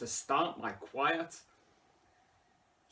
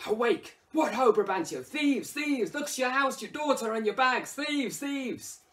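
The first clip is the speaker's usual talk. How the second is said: high, loud cry of alarm up at a window; raised alarm call; 13 semitones higher, 7 dB louder than usual. Acted. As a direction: rouse the house, shouting thieves. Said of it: "using more exclamatives and rhetoric".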